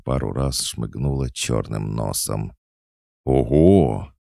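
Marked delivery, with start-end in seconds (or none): 3.2-4.1 loud, wowed exclamation; boundless delight. Acted. Boy seeing a talking fox doll, delighted. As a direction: gasp out your admiration. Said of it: "Восхищению Толика не было предела".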